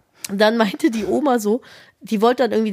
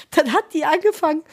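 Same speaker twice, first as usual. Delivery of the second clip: ordinary delivery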